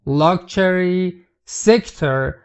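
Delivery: luxury drawn out slowly, syllable by syllable; teacher stretches luxury out slowly; slow model word. Shown as a demonstration. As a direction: spell out luxury slowly for learners.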